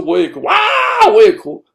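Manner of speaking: high, loud mock wail; out-wail a crying child in play. Father competing with his crying child, loud mock bawling. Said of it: "比赛看谁声音".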